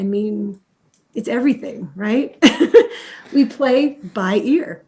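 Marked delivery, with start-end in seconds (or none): 2.5-3.3 sudden high airy laugh; high burst of laughter. Real, not acted. Amused by her own point, laughs high and breathy. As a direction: break into a bright laugh.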